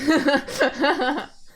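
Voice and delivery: loud, high burst of giggles; laughter. Real, not acted. Reader amused by repeated pronunciations, real laughter. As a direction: laugh out loud, pitched well up.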